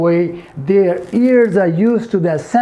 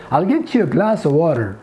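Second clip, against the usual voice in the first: American linking, "get you" as "get-chu"; American accent. Shown as a demonstration. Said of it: "But if you say". (American लहजे में)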